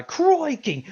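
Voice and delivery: high-pitched, over-the-top Australian exclamation; hammed-up Aussie accent; exaggerated Australian accent. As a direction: imitate an Australian zookeeper with a broad accent. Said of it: "he just talks like an exaggerated accent".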